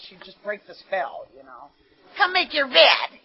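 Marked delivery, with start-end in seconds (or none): 2.2-3.2 louder, higher bossy mom call; mother calling. Retold or imitated. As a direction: call out like a mother summoning her kid. Quoting mother calling her in.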